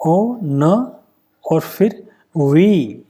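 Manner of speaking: "O", "न", then "वी", each sound set apart by pauses; sound-by-sound breakdown. Shown as a demonstration. Teacher breaking the transcription down for students, O, न, वी one by one. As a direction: spell out ennui by sound: O, न, long वी. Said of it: "the sound of O".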